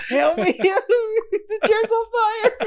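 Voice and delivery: high, slow, laughing wail for help; hammed-up panic in a burning seat; mock cry for help. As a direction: plead dramatically through laughter. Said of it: "mock pleading".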